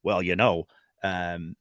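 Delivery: deep, hesitant Albertan drawl; prototypical Albertan voice. Shown as a demonstration. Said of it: "my prototypical Albertan voice is a little bit more".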